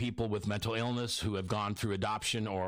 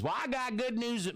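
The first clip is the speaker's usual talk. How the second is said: put-on Southern accent; 13 semitones higher than usual. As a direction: imitate the publisher's Southern accent. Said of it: "he's got a Southern accent".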